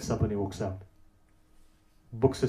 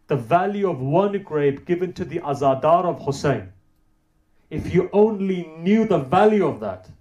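high, loud, swelling emphasis; fervent raised sermon voice; impassioned preaching; 5 semitones higher, 7 dB louder than usual. Real, not acted. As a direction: press each phrase home with rising fervour.

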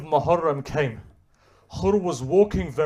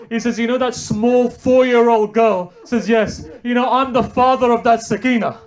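high, loud, soaring sermon voice; mourning preacher at full cry; impassioned preaching; 6 semitones higher, 6 dB louder than usual. Acted. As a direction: preach it at full force, pleading. Preacher builds to climax.

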